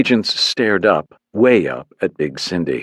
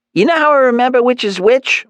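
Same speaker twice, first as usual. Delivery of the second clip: Mom voice; 4 dB louder than usual. A narrator's reading. Mom asks son.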